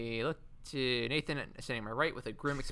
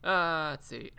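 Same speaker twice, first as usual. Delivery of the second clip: ordinary talk, nothing marked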